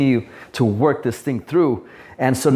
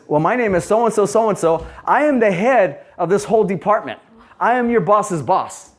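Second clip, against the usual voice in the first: raised, puffed-up voice of a self-important boss; pompous boss; 7 semitones higher than usual. Retold or imitated. Boss puffing himself up to the worker, pompous. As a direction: play the big shot announcing his rank.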